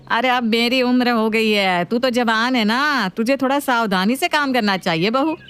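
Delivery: mother-in-law. Acted. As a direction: preach it like an elder.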